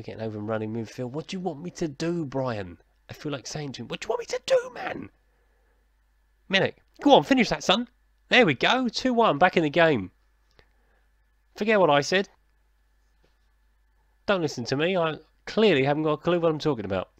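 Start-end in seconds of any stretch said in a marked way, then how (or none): 1.1-2.8 higher-pitched, put-upon protest; exasperated appeal. Acted. Gamer answering Brian about his tactics, exasperated appeal. 3.9-5.1 very high, mock-desperate protest; exasperated squeal. Gamer imagining his retort to Brian, exasperated high squeal. 6.5-17.1 loud, high-pitched, jubilant commentary; excited goal celebration. Real, not acted. Gamer cheering Milik's goal back into the game, real excitement.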